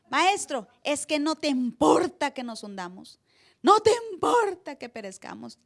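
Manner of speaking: más fuerte y agudo, con reproche; reclamo de discípulos. Retold or imitated. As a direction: suelta el reclamo como un grito urgente. Acting disciples' reproach. (los discípulos reclaman al Maestro dormido, alzando la voz)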